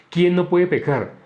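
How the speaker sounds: ordinary delivery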